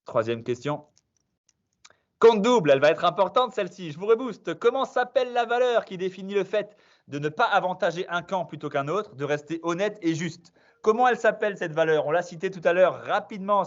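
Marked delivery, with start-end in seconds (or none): 2.2-13.7 bright quiz-master hype; quiz host announcement. Acted. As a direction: pump up the players as you read the question. Energetic quiz host reading question.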